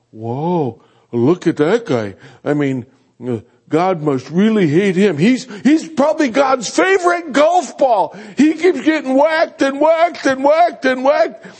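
put-on high, goofy kid voice; mocking kids. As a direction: jeer like a cheeky child.

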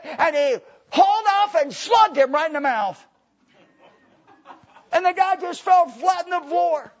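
high, loud pulpit storytelling; full-force preaching. Acted. Preacher telling the congregation of a prison punch, shouted high and loud.

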